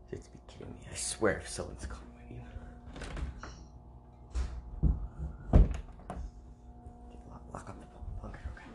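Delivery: tense hushed whisper. Real, not acted. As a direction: whisper like hiding from someone. Hiding, whispering about someone calling.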